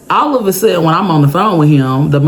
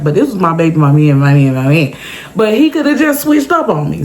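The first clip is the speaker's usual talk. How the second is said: ordinary delivery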